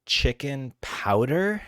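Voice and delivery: a vet appalled by what he heard; disgusted vet. Retold or imitated. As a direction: repeat it back in disbelief. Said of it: "he was disgusted".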